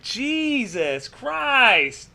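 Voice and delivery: very slow, stretched Jesus Christ; drawn-out disbelief. Real, not acted. S0 stunned by a viewer's story, slow awed disbelief. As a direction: let it out slowly in disbelief. Reacting to granddad hanged.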